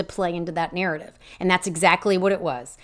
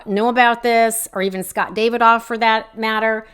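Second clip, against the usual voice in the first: usual speaking voice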